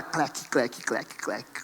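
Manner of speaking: mimicked shutter clicks, fading to a hushed whisper; voiced camera shutter noises; camera click sounds.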